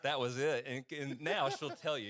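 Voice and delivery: usual speaking voice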